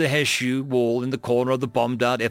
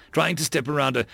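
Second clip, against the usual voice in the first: ordinary delivery, nothing marked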